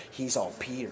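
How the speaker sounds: usual speaking voice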